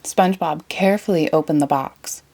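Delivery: ordinary delivery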